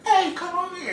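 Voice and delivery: high-pitched mock shout; imitated holler across a party; calling over music. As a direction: call out as if over club music, without real volume. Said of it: "they can't hear you".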